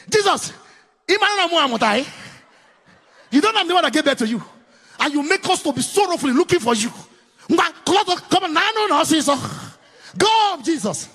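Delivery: high, loud scolding; mock scolding mother. Mary scolding young Jesus after searching three days. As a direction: scold the lost boy.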